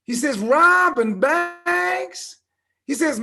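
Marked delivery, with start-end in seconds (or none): 0.4-2.4 very slow, high Southern drawl; hammed-up Southern drawl, pitched way up; bad southern drawl. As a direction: stretch it out in incredulous Arkansas drawl. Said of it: "Excuse my bad southern drawl".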